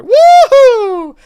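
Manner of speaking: high, loud woohoo; excited cheer. Real, not acted. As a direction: whoop with joy. Cheering at the result.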